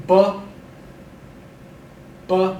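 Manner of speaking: Bu with a very short clipped schwa. Shown as a demonstration. Teacher models the short schwa, clear quick model. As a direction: keep the vowel in Bu short. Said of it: "Keep the schwa short".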